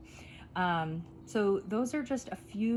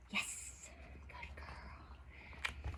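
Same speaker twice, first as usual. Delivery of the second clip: hushed whispered praise; 14 dB quieter, much slower than usual. Real, not acted.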